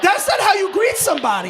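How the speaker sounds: indignant. Retold or imitated. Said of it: "I'm like".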